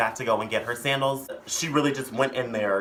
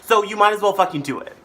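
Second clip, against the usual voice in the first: higher, louder push; insistent; 8 semitones higher, 8 dB louder than usual. Real, not acted. Urging viewers to subscribe, insistent. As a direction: press it on them, loud and high.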